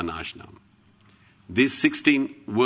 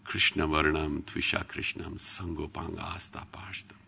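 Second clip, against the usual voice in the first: soft, fading into a whisper; hushed scripture, almost whispered; hushed recitation; 3 semitones lower, 7 dB quieter than usual. Acted. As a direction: murmur the verse like a secret.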